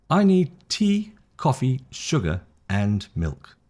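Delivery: slow list, "tea", "coffee", "sugar" rise, "milk" falls; rising and falling list. Shown as a demonstration. Teacher models a shopping list for learners, rise, rise, rise, fall. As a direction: lift each item, then drop on "milk". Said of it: "Rise, rise, rise, fall".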